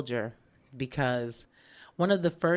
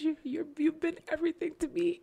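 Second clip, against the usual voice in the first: high, tearful farewell to the car; crying as the car is towed away; tearful goodbye; 13 semitones higher than usual. Retold or imitated. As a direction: choke up saying goodbye to your car. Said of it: "I'm crying and I'm like".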